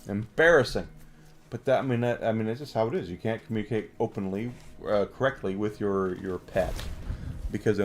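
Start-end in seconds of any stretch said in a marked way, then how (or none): none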